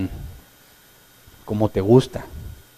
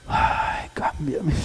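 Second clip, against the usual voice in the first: breathy whispered mock plea, half sobbing; mock tearful whisper; 2 semitones higher, 5 dB quieter, much slower than usual. A believer mocked while praying, whispering tearfully. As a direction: whisper it like a hammed-up crying prayer. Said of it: "yo hasta lloré".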